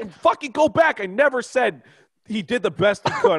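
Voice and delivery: high, loud furious denial; yelling. Real, not acted. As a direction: scream your denial, outraged. Heated podcast argument over quote.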